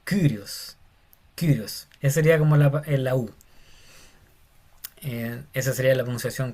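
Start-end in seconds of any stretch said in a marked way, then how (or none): 0.0-1.9 Kyrios with the y said like French u. Shown as a demonstration. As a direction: round the lips on the y in Kyrios. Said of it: "al francés".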